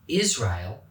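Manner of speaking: usual speaking voice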